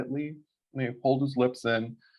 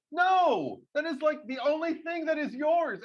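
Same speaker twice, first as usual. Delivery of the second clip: much higher, outraged no; a mock-outraged defence of the kid; indignant protest. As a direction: insist it's the kid's own, indignant. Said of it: "I'm like".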